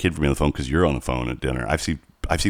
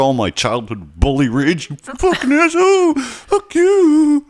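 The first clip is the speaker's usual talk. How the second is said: fantasy rage; 12 semitones higher than usual. Retold or imitated. Narrator imagining threatening the neighbor with a tire iron, mock fury. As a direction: scream it like a childhood bully finally cornered.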